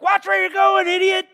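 high mock yell of a little boy; kid yelling at a driver. Little son mimicking dad's road rage, high yelling. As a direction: shout it out the truck window like a kid copying dad. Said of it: "He's like".

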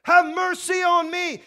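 loud, high, drawn-out shout for help; full-voiced pleading cry. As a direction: holler it desperately over a crowd, stretching the words. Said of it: "he cried out and said".

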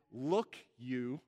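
a low, curt "Look, you" with a jab in it; scolding look. Retold or imitated. Older son confronting his father, curt and accusing. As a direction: snap it like pointing a finger. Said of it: "the look is".

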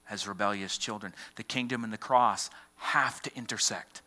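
ordinary delivery

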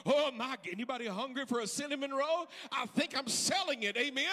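loud, high, rising to a chanted shout; full-force preaching. Acted. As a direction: build the congregation up to a roar.

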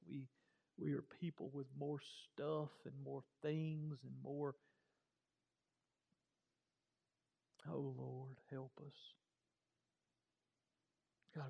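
soft, low prayer voice. Acted. Preacher praying for the congregation, softly. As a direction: pray humbly and quietly to God.

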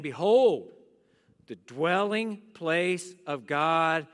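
slow, low, reverent reading; solemn scripture reading. Acted. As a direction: read it slow and hushed, like holy words. Reads the scripture slowly.

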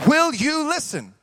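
high, ringing appeal; preaching climax. Real, not acted. Preacher challenging the congregation to listen, full-force appeal. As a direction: press the question on the whole church.

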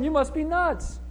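high-pitched, insistent dismissal; scoffing disbelief. Acted. Praying believers rejecting Rhoda's news, in high-pitched disbelief.

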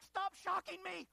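high, loud, drawn-out mock outcry; acted protesting yell. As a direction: yell it like a patient fending off the paddles. Patient yells at the medics.

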